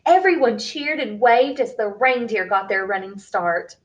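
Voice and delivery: usual speaking voice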